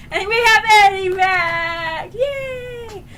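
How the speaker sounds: high, drawn-out happy cheer; gleeful cheer. Real, not acted. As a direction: celebrate with a squealed hooray. Celebrating the character's return.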